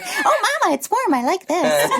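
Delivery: high, cute cat voice with a laugh; high laughing pet voice, mama's kitty; voicing her cat. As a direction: play the contented cat, high and giggly. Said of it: "my cat sitting on it, like".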